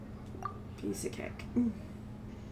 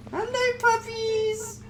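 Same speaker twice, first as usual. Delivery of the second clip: cooing; 12 semitones higher, slower than usual. Acted. Greeting puppies in the game.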